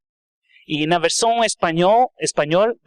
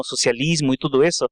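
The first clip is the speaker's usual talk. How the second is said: ordinary talk, nothing marked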